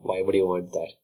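ordinary talk, nothing marked

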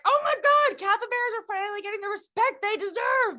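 very high, squealing delight; gushing excitement. Retold or imitated. Telling the host about capybaras, squealing excitement. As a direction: gush like a capybara superfan. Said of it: "and I was like".

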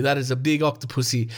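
normal talking voice

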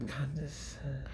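barely voiced murmur; hushed echo. Husband repeating wife's word condescending, hushed echo. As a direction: echo it very softly, under your breath. Echoes her word under his breath.

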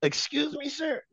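high, soft, overly polite query; mock-polite disbelief. Challenging the co-host over his Eagles slight, mock-polite.

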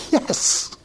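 breathy, voiceless mock cheer; whispery celebration, played for the story; retold excited yes. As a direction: act out a hushed, hissed cry of triumph. Retelling his relief at being understood.

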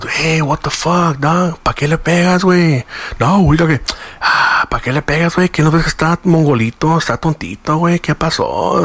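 high, indignant Spanglish telling-off; imitated scolding manager. Assistant manager asking why he hit Harry, imitated indignant scolding. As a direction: play a protesting manager, pitched well up.

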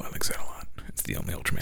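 whispering. Real, not acted. Unvoiced aside.

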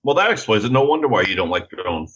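ordinary delivery

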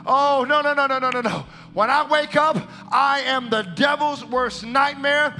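loud, high, impassioned sermon delivery; rally the church with fervent declarations; full-force preaching. Real, not acted. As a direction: build phrase by phrase to a rousing climax.

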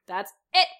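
Michael snapping at the two Janets; snapped. Acted. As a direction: snap it with fed-up anger. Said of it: "Michael snapped".

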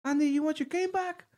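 high-pitched teasing offer; funny taunt. Retold or imitated. Friend approaching Andy from the street, high funny taunt. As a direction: imitate a friend's sing-song bait. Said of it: "Like that, all funny".

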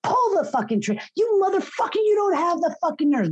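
very high, loud taunting scream, fast at the end; retold screaming. Woman taunts abusive husband holding gun, shrill furious scream. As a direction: hurl the taunt at him at full voice. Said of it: "and I'm screaming at him".

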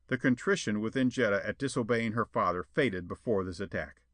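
ordinary delivery

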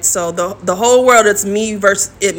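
usual speaking voice

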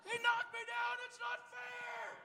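put-on piercing high-pitched child's tantrum yell; top of his lungs. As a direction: imitate a little boy screaming in outrage. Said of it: "Just at the top of his lungs".

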